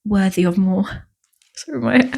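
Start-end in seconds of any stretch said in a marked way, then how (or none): 1.6-1.8 higher, softer "Sorry" said through a laugh; giggly sorry for losing her English; laughing apology. Real, not acted. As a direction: laugh at your own slip.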